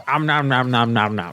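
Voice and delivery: chewing goat nom-nom; munching noises. Acted. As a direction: chomp it out like a goat. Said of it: "it voices".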